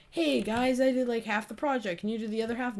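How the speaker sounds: lightly voiced friendly classmate. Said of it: "It's not like".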